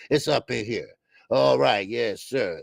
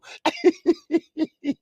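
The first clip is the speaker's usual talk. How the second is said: high wheezy giggle; long, high tittering laugh; real laughter; 4 dB louder than usual. Real, not acted. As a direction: keep cracking up, pitched way up. Still amused by the retold exchange.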